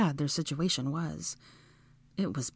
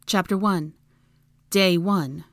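usual speaking voice

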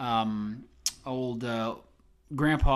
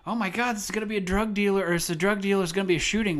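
high, louder imitation of alarmed kids; mock panic; 8 semitones higher, 3 dB louder, faster than usual. Host retelling the kids' reaction to the car, mock panic. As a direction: rattle off the kids' worry in a raised voice.

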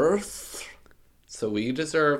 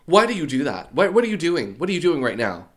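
scolding mom; 5 semitones higher, 5 dB louder than usual. Retold or imitated. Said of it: "She's like".

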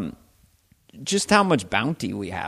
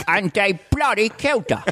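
higher, outraged Cockney outburst; Michael Caine; 8 semitones higher than usual. Shown as a demonstration. Comedian showing the actor's rising delivery, outraged Cockney. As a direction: let the London accent climb. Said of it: "he goes up".

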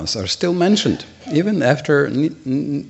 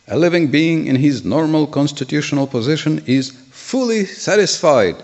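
higher-pitched, declaimed commentary reading; raised reading voice, deliberate phrasing; formal scripture reading; 6 semitones higher, 5 dB louder than usual. Real, not acted. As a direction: read it out with emphatic phrase endings. Reads the commentary aloud.

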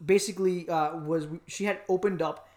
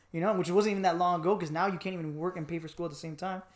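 usual speaking voice

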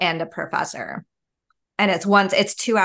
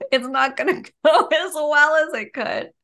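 laughing through the words; giggling while admitting it won't go well; 9 semitones higher than usual. Real, not acted. To friends about her class, laughing.

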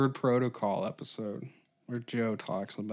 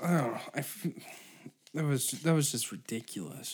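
ordinary talk, nothing marked

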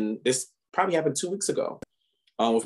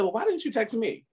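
pitched way up, demanding; indignant high challenge; 11 semitones higher than usual. Retold or imitated. Confronting a friend at dinner, indignant high challenge. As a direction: call him out sharply. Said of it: "I said".

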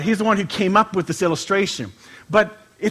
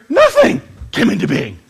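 scripture reading; 2 semitones higher, 6 dB louder than usual. Acted. Reads scripture.

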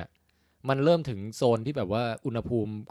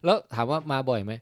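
usual speaking voice

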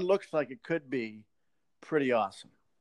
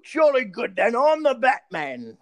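slow, posh British Batman; British accent; 5 semitones higher, 5 dB louder, slower than usual. Pretend. Batman introducing himself in a British accent. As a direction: announce yourself like a proper English gent. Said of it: "if Batman was British".

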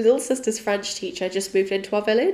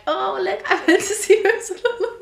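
laughing high; 11 semitones higher, 3 dB louder than usual. Pretend. Showing her kids the ticket.

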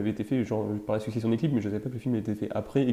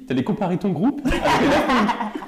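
teasing laugh; 10 semitones higher, 6 dB louder, slower than usual. Real, not acted.

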